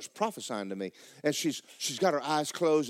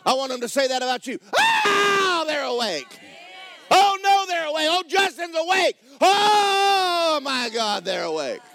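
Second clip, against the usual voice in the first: loud, high preaching shout; 8 dB louder than usual. Acted. Preacher shouting the devils' dismay to the church.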